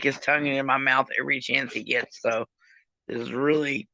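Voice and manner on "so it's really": normal talking voice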